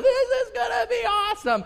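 very high mock child squeal; excited kids. Kids ripping open the seaweed package, gleeful. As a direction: squeal with hammed-up anticipation.

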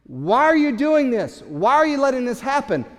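loud, high demanding cry of frightened disciples; panicked accusation. Retold or imitated. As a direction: hurl the question at him in alarm. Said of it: "the disciples".

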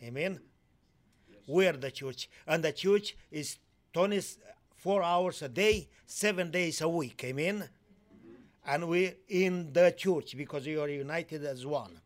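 usual speaking voice

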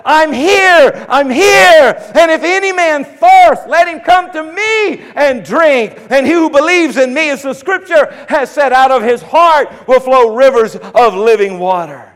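high, loud proclamation; Jesus answers the crowd; crying out. Retold or imitated. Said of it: "And Jesus says".

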